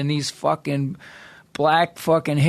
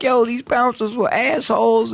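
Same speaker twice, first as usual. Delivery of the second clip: higher, mildly defiant excuse; defensive friend; 7 semitones higher than usual. Retold or imitated. Brian defends himself to his friend, lightly voiced. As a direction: shrug it off as Brian would. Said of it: "He's like".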